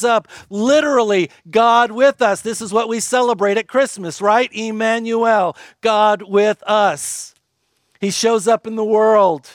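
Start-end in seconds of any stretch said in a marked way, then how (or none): 4.5-7.4 drawn out and weighty, each word given space; slow emphatic proclamation. Acted. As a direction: proclaim it slowly so it lands. Sermon climax on key phrase.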